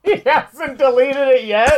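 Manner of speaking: high, loud incredulous laugh; cracking up in disbelief. Real, not acted. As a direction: squeal it through laughter.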